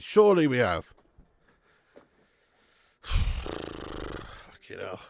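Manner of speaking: ordinary delivery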